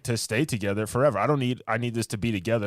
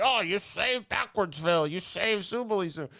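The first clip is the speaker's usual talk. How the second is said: mock grateful zoo guy; 7 semitones higher than usual. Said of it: "the guy's like".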